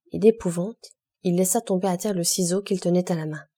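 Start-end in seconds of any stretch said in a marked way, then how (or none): none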